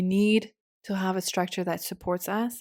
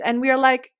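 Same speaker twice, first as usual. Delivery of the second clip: normal talking voice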